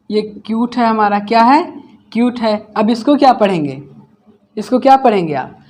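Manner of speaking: usual speaking voice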